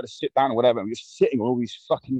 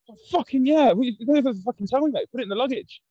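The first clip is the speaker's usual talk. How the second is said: put-on excited teen self; 14 semitones higher than usual. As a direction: hype it up like a greedy kid. His own excited younger self.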